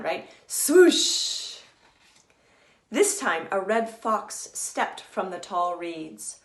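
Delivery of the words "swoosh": loud hissing swoosh of grass; big rushing swoosh; swooshing grass sound. Shown as a demonstration. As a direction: blow it out like wind through reeds. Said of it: "That's a good S sound".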